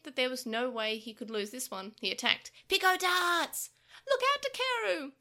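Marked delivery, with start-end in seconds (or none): 2.7-5.1 high, loud, slow attack call; battle shout. Acted. Creatures shouting in battle, high and loud. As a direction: yell the attack name.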